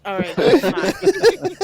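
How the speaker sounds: high, slow and loud offhand voice; slow dismissive ghost. Retold or imitated. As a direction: drawl it like an unbothered ghost.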